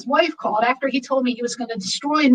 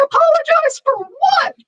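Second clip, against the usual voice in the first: much higher, slow indignant question; indignant disbelief; 3 dB louder than usual. Retold or imitated. Told by mentor to apologize, higher slow disbelief. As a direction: stretch it out, outraged she must apologize.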